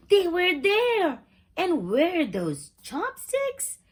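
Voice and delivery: high and loud, then slow puzzled question; shock, then squint at the chopsticks; startled disbelief. A narrator's reading. Narrator marveling that the underwear came back, startled.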